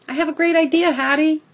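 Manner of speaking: high, loud eager pitch; cute dog bark. A narrator's reading. Dog coaxing Hattie to play, high and eager. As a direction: sell the idea like a playful pup. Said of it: "I bark, cocking my head".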